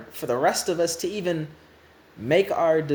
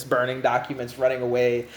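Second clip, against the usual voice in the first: usual speaking voice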